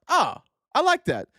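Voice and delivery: high, pleased approving voice; charmed voter, a touch hammed up; mock charmed voter. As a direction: sound won over. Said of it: "people are like".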